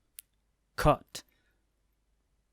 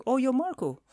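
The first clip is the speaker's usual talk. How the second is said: surprised Robin; 12 semitones higher than usual. Retold or imitated. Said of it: "Robin's like".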